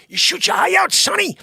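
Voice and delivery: high, loud shouted warning; mock warning shout. Warning Sonny he'll shoot his eye out, yelled high. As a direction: yell the warning like a frantic parent.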